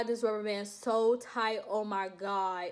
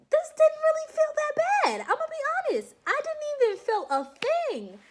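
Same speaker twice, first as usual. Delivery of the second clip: much higher, louder, amazed it didn't hurt; excited disbelief; 6 dB louder than usual. Real, not acted. Telling viewers about the blood draw, high amazed squeal. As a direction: squeal how easy the needle was. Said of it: "surprised relief".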